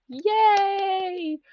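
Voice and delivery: excited cheer. Real, not acted. Celebrating eleven month anniversary.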